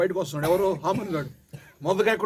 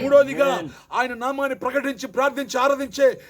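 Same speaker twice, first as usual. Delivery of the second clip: high, loud climactic shout; preacher shouting over the congregation; preaching at full force; 6 semitones higher, 6 dB louder than usual. Real, not acted. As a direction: build to a roaring peak.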